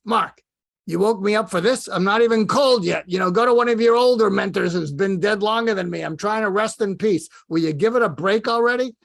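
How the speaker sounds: put-on loud, high, exasperated Brooklyn accent; Larry King. Woken dead mentor berates mentee, loud Brooklyn grumbling. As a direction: complain at full tilt in Brooklynese. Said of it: "in his Brooklyn accent".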